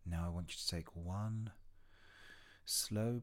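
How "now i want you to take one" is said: usual speaking voice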